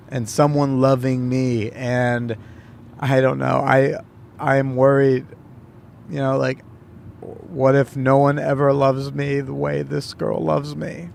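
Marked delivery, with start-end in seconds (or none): none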